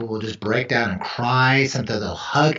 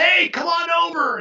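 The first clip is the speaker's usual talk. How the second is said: calling out. Retold or imitated. Calling out to shoppers.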